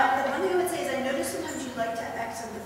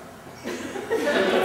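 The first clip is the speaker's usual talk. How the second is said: short breathy real laugh; 8 semitones higher, 3 dB louder than usual. Real, not acted. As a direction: let out a little laugh. Teacher laughs mid-sentence.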